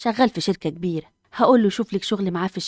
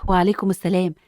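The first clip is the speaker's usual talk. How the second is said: normal talking voice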